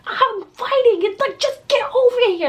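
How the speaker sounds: high, urgent demand to get over here; retold pissed plea to dad. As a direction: beg dad to hurry, fired up. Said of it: "I was like".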